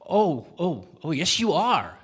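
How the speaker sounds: loud, high, gloating insistence; oh yes you are, hammered home; insistent baker. Acted. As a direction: baker insists the man is stealing, loudly. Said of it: "said the baker".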